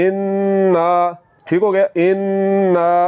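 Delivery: इन्ना with the nasal n held long. Shown as a demonstration. Quran teacher demonstrating to students, holding the nasal sound. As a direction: stretch the n of इन्ना through the nose. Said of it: "नून के ऊपर ज़बर को थोड़ा सा लंबा करेंगे".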